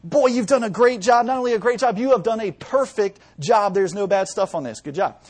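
high, loud gushing praise; proud praise. Acted. Father praises son's spotless paper, proud and gushing. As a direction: beam over the kid's perfect work. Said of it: "he looks at all the good stuff and he says".

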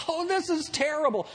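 high-pitched mock lament; frustrated. Preacher voicing frustrated people watching the news, frustrated. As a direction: wail it a little, high and dismayed. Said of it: "you get frustrated and you say".

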